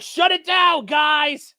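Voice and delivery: high, loud commanding shout; mock order shouted. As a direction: yell the shutdown order. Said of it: "They'll be like".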